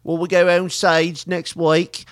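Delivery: exaggerated Australian interview voice; mock Australian accent. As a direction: imitate an Australian star being interviewed. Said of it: "Listen to her voice right here".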